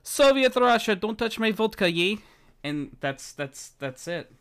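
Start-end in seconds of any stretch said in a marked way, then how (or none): 0.0-2.2 high, loud hammed-up Russian accent. Showing where every accent ends up, hammed-up Russian accent. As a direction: burst into a cartoon Russian accent. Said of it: "all I can do is, is Russian".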